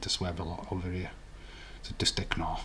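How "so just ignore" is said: hushed aside, voice dropped to a whisper. Real, not acted. Whispering to the young Canadian viewer about swearing.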